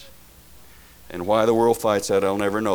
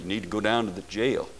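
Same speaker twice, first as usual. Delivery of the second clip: ordinary delivery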